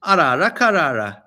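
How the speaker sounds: hammed-up magic words; mock spell casting. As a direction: cast Arara karara as a playful spell. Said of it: "you only need two words".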